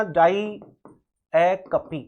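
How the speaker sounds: "diacopi" drawn out very slowly, die-AK-o-pee; teacher spells out the sound of "diacopi" syllable by syllable; careful pronunciation. Shown as a demonstration. Teacher shows students how to say the term, very slowly. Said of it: "pronunciation भी ध्यान रखना".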